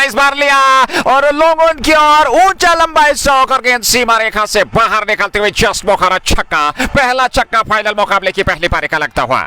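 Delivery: rising excited call of the six; home run call. Real, not acted. Six hit, commentator calls it.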